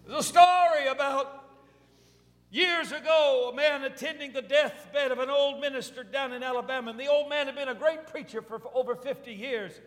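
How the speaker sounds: impassioned sermon anecdote; full-force preaching. Real, not acted.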